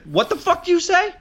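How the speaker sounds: high-pitched, mock-offended jab; mock outrage. Imitating a star player jabbing a teammate, in mock outrage. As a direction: act baffled and offended. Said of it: "He's like".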